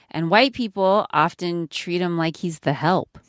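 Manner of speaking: ordinary delivery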